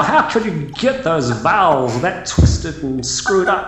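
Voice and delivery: ordinary talk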